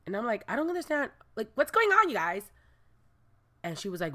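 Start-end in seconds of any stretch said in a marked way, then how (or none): 0.5-2.5 high-pitched puzzled reenactment; high, bewildered mock question; confused questioning. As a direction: act out baffled questioning, voice lifted high. Acting out her confused reaction.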